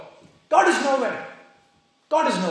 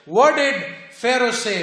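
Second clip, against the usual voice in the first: usual speaking voice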